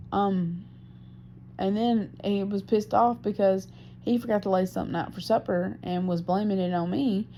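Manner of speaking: normal talking voice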